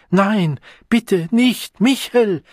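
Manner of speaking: high, loud panicked plea; shrinking, desperate cry; frightened begging. Acted. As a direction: beg them not to hit you. She begs, shielding her face.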